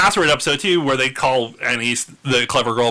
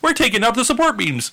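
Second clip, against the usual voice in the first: Richard's voice; 7 semitones higher than usual. Retold or imitated. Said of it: "because he's like".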